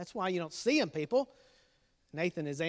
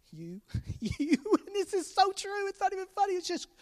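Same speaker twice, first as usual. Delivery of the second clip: high laughing teasing; laughing tease; 10 semitones higher than usual. Real, not acted. Dad teasing his kids about stinking. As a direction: giggle at the truth.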